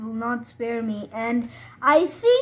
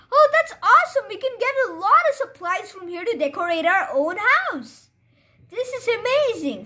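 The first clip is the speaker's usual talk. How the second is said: thrilled; 7 semitones higher than usual. Real, not acted. Gamer discovering a village, thrilled and high. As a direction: gush over the find.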